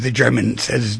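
ordinary talk, nothing marked

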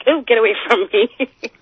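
disgusted mother, imitated. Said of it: "She's like".

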